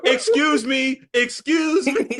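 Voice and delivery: high, loud call; hammed-up indignant excuse me. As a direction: shout to get past. Mock shoving past dog.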